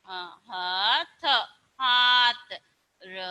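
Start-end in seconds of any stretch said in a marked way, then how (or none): none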